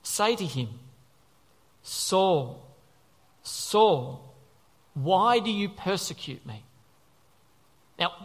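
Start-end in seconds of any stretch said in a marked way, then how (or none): 1.8-6.6 slow, spaced-out voice from heaven; measured divine voice, each name set apart. A narrator's reading. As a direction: call the name slowly, with weight. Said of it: "heard a voice".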